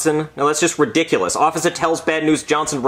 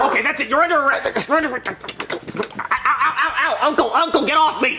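1 yelping cop; 13 semitones higher than usual. Acted. Cop being beaten.

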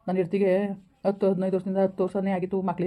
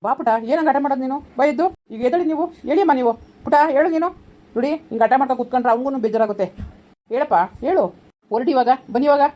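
high, loud, impatient urging; insistent coaxing; 6 semitones higher, 6 dB louder than usual. Acted. Adult hurrying a stalling child to leave, insistent coaxing. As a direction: press them to get up and leave.

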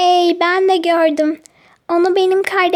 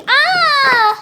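dismayed cry; 7 semitones higher than usual. Acted. Bike taken away suddenly.

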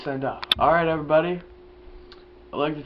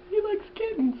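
high singsong tease; mocking childish taunt; teasing. Teasing the puppet.

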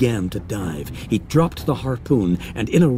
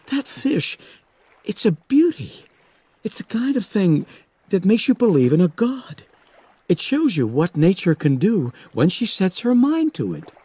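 high, rapt voice praising the fish; awed young Hooper; 10 semitones higher than usual. Acted. Hooper praising the shark to Quint, high and awed. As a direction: Hooper lost in wonder, pitched high.

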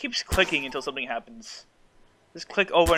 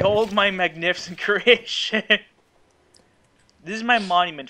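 pompous vain hero; 5 semitones higher, 4 dB louder, slower than usual. Acted. Grandly presenting welded creation.

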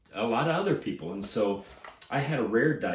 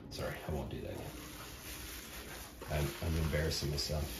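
ordinary delivery

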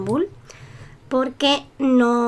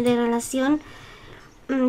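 normal talking voice

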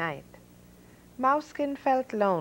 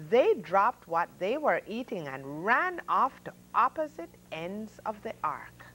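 ordinary delivery

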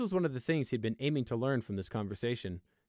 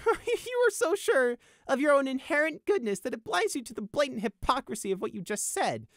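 high, loud mocking accusation; giggly high voice, settling slightly; giggling scorn; 5 dB louder than usual. A narrator's reading. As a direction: gasp it out in gleeful ridicule. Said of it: "Prey giggled harder".

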